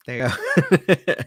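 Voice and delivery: chuckling through the words; laughing. Real, not acted. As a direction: laugh it off, slow and amused. Jokingly dodging the recap.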